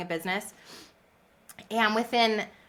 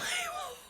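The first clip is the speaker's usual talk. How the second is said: a sudden high airless giggle; high breathless laugh; 10 dB quieter than usual. Real, not acted. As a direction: burst into a high silent laugh. Laughs at her own reaction.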